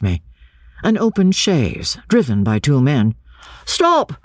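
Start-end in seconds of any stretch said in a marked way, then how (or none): 3.6-4.1 sudden high-pitched call to halt; crying out. A narrator's reading. Man in chaise ordering driver to halt, crying out. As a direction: call out sharply, voice leaping up. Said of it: "cried one".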